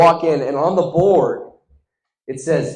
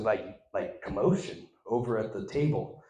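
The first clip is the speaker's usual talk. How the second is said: usual speaking voice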